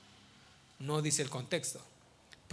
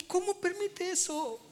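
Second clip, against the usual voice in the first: mock disbelief; 12 semitones higher than usual. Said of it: "Yo digo".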